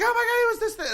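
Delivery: very high squeaky excuse; mock her panicked excuse way up high; mock flustered server.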